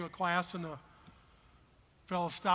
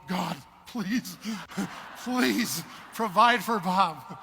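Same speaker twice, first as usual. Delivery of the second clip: louder, very slow pleading with God; mock pleading prayer; 6 dB louder, much slower than usual. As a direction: drag out the plea like a desperate prayer. Praying for Bob.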